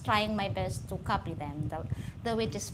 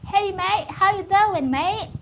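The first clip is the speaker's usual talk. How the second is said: loud, high mock-Australian hail; Australian accent; 10 semitones higher, 10 dB louder than usual. Comedian imitating Australians greeting a mate, loud Australian accent. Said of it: "I heard a lot of Australians also saying".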